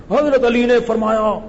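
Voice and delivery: high, forceful mock-cleric cry; strained, high pulpit declaiming, exaggerated; ear-splitting preacher imitation. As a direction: imitate a preacher blasting the hall. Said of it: "میرے کان پھٹ رہے تھے نا ان کی تقریر".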